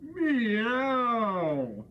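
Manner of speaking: long falling sung meow; cat meow. Pretend. Child sings the cat's meow at the window. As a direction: sing it like a lazy cat. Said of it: "The cat sang".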